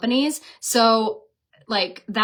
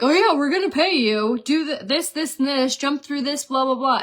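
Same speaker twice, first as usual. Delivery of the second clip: higher, sing-song mock of the brand's promises; 5 semitones higher than usual. Influencer mocking the brand's excuses to her viewers, sing-song mock voice.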